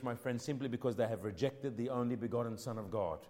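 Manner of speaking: ordinary talk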